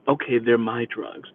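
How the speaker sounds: low, soft, subdued confession; quiet admission. Retold or imitated. Caught teen admitting to the driver, low and quiet. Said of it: "He got really quiet and he said".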